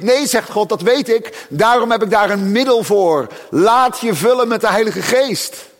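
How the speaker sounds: loud, high, fervent preaching; preaching at full force. Acted. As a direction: preach it with rising fire. (vurig, luid preken)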